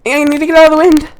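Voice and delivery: high, fast flustered falsetto; falsetto girl. Acted. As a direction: hurry it out as the panicked girl. Voicing the girl.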